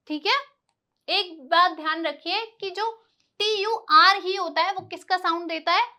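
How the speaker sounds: normal talking voice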